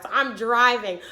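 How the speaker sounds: ordinary talk, nothing marked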